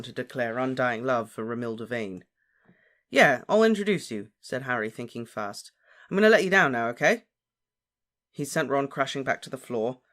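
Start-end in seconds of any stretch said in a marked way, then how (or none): 3.1-4.3 high, loud, quick-witted assent; placating. A narrator's reading. Harry humours dangling Ron, placating. 6.1-7.3 raised, louder warning; placating. A narrator's reading. Harry prepares to release Ron, placating. Humours friend before releasing him.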